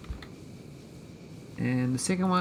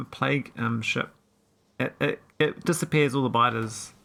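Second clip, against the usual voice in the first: usual speaking voice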